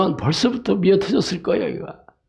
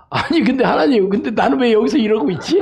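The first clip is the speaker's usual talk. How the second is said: higher, animated mock complaint to God; retold complaint; 6 semitones higher, 4 dB louder than usual. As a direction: plead like the puzzled baby camel. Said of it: "저 그랬어요".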